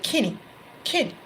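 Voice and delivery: Kenny said with a country twang, like "Kinny"; country accent. Shown as a demonstration. Psychic relays the name "Kenny" as heard, in a country accent. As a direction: say "Kenny" country-style, first vowel as in "kin". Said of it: "in a country, uh, country tone".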